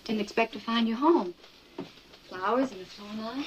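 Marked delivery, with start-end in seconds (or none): 2.3-3.5 slower, drifting, lost in thought; a wife repeating herself without noticing; distracted repeat. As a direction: say it again absently, half elsewhere. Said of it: "You said that twice".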